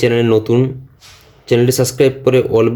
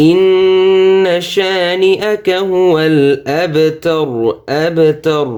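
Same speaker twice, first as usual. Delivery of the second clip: higher, slow melodic chant; slow tilawat; Quran recitation; 6 semitones higher, much slower than usual. Shown as a demonstration. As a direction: draw the vowels out. Recites the verse.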